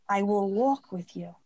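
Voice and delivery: slow, low-pitched promise; inner male voice. Retold or imitated. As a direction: imitate a steadying voice, unhurried. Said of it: "That's what he says to me".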